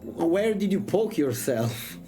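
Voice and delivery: usual speaking voice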